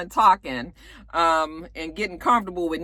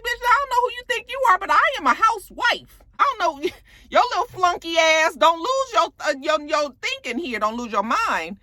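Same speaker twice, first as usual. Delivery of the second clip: much higher, fast, heated tirade; mock furious Nene; 8 semitones higher than usual. Nene warning Yovanna at the car, fast high angry rant. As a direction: rattle it off high and fed up, meaning every word. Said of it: "And she was serious".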